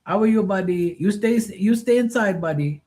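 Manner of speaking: put-on high, polite Filipino-accented "sir" voice; imitating Filipino pool guy. Pool cleaner greeting Mark politely, in a high Filipino-accented voice. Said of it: "He goes".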